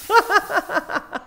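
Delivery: loud, high, jeering "ha ha"; mocking laugh. Repeating the commenters' jeer, loud and mocking. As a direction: throw the troll's laugh back. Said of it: "some of the first comments I ever got".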